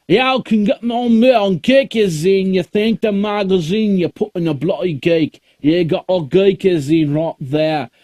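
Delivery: high, loud mock Cockney plug; hammed-up Cockney pitch for his handle; dirty British accent. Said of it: "dirty British accent".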